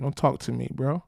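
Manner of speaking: normal talking voice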